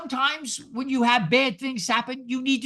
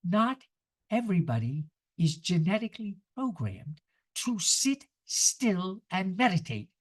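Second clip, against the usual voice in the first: slow, deliberate and softer; slowly; 3 semitones lower, 3 dB quieter than usual. Shown as a demonstration. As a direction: spell it out word by word. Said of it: "Let me say that slowly".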